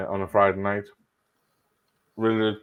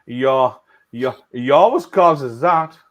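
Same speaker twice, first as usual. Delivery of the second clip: imitating a stammer, louder, with stuttered restarts; mock stammer; 4 semitones higher, 9 dB louder than usual. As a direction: stumble over he, uh, he again and again. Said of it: "I didn't know he had a stammer".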